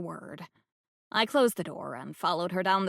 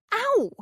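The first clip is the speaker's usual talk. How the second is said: a much higher, hammed-up yelp; mock yelp of pain; 5 dB louder than usual. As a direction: fake a little cry of pain. Pretends shoe trouble.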